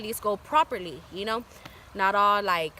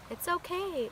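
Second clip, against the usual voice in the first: mock sweet calm; 9 semitones higher, 6 dB quieter, slower than usual. Watching a stranger buy her lamp.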